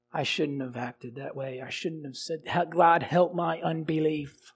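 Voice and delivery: hushed, low confession to God; soft, remorseful admission; penitent prayer. Acted. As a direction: drop the voice as if praying privately.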